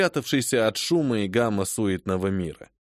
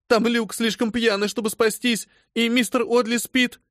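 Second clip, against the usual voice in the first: frantic; 11 semitones higher than usual. Acted. Phoebe fearing her husband and Mr Audley will die. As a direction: cry it out in panic. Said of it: "рыдала девушка".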